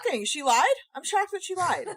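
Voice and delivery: high-pitched mock shock; sarcastic. Co-host pretending shock that she lied, sarcastic. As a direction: feign utter astonishment, pitch leaping up. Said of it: "that was sarcasm".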